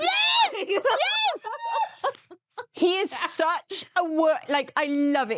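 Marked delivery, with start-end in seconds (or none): 0.0-2.2 shrieking laughing yes. Real, not acted. Laughing agreement.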